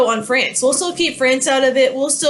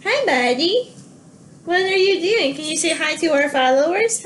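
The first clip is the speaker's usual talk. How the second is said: higher, cooing voice for the dog; pet talk; 3 semitones higher than usual. Real, not acted. Teacher interrupts her lecture to coo at her dog.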